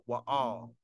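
"wa'al" begun with a w sound; dialect reading with w. Shown as a demonstration. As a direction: say "wa'al" with w in place of v. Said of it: "They would say right here".

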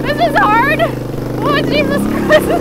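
tense and strained. Real, not acted. Struggling up the steep hill.

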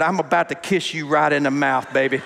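low, playful romantic come-on; mock seducer. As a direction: drop the voice for a mock-smooth advance. Acted amorous line to his wife.

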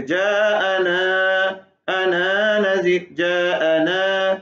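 जाअना with a long drawn aa, very slow; stretched jaana. Shown as a demonstration. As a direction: recite जाअना Nazir with a long aa. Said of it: "वहां लंबा करेंगे". (लंबा खींचा हुआ जाअना)

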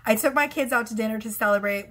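usual speaking voice

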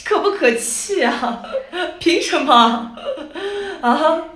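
slow, laughing complaint; mock outrage through laughter; laughing indignation. Real, not acted. Vlogger laughing while complaining about the price.

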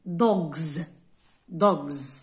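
"دوغز" loud, ending in a buzzing voiced z; "دوغز" punched out with a voiced z ending; final Z sound. Shown as a demonstration. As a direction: say each "دوغز" firmly with z, not s. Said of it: "تنطق زاد".

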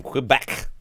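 Quebec with a "kw" start; mispronounced Quebec. Real, not acted. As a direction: say Quebec with a w-glide after the K. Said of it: "I'm saying it wrong".

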